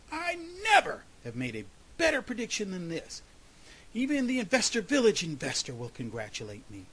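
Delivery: high, quick boast starting loudest; excited yell. A narrator's reading. Groundhog running through the woods, excited yell. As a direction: crow with pride as you run. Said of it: "He yelled through the woods".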